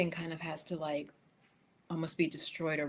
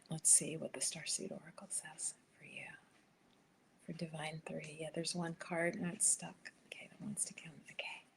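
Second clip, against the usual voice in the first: whisper; 13 dB quieter than usual. Real, not acted. Whispering while pulling card.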